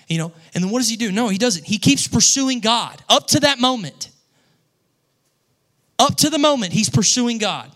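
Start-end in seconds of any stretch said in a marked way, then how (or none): 1.7-7.7 much higher and louder, driving it home; fervent preaching. Acted. Pastor telling the church Jesus kept pursuing God, fervent preaching. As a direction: hammer the point with full fire.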